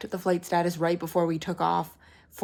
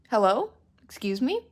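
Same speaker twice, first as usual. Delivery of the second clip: retold incredulity; 3 semitones higher than usual. Passenger told bags never needed collecting, mock incredulous. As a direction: act out being taken aback, each question lifting up. Retold disbelief at agent's answer.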